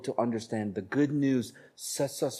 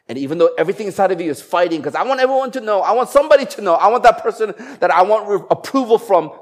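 rising, louder cry of wanting to be known; urgent craving; 9 semitones higher, 9 dB louder, faster than usual. Retold or imitated. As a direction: let the need to be noticed burst out. Preacher voicing inner craving.

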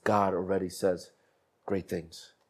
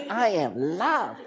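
put-on higher, slow little girl's voice; 7 semitones higher, much slower than usual. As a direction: imitate a small girl saying it to the mirror. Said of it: "She's like".

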